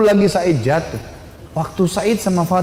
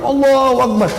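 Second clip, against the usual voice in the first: high, ringing cry of praise; the believers cheering Umar's conversion, ringing shout; joyful takbir; 8 semitones higher than usual. Acted. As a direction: call it out in triumph. Whole house shouts takbir.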